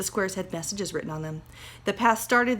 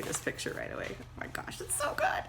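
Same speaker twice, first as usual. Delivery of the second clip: whispered aside; 9 dB quieter than usual. Real, not acted. Teacher's excited aside at picture.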